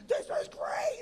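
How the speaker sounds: mock-imitate a thrilled stranger shrieking; going ballistic. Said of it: "she's going ballistic".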